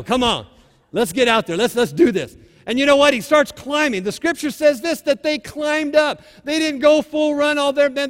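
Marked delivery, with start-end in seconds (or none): 0.0-2.4 rallying cry. Real, not acted. Preacher rallying the congregation.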